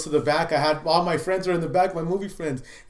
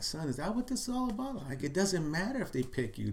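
tender reassurance; 3 semitones higher, 8 dB quieter than usual. Retold or imitated. Father comforting crying son.

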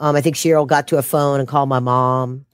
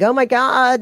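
shocked gasp; 8 semitones higher than usual. Retold or imitated. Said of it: "she's like".

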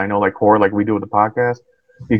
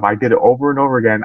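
usual speaking voice

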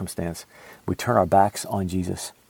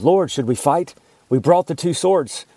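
urgent, higher and a little louder; excited exclaim; 7 semitones higher, 4 dB louder than usual. Acted. Disciples urging their Lord to fight, urgent exclaim. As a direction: disciples eager to jump into the fight. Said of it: "they exclaimed".

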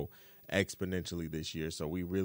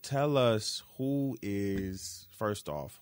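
ordinary talk

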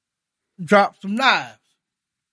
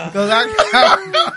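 higher, louder, slow through laughter; words squeezed out while laughing; 4 semitones higher, 7 dB louder, slower than usual. Real, not acted. Friend still laughing as he asks to join in.

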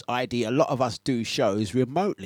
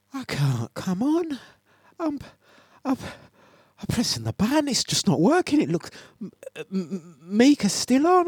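imitating Jazzy; 9 semitones higher than usual. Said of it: "he does go, he goes".

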